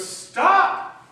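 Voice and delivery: high, loud shout; yell. Retold or imitated. Jesus yelling at us to stop, high and loud. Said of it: "Jesus yells to us".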